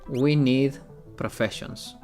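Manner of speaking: ordinary talk, nothing marked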